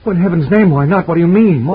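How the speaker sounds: alarmed outburst. Acted. Husband pressing the family friend about his wife, alarmed and urgent. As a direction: push the question out in sudden alarm. Larry alarmed by Maud's warning.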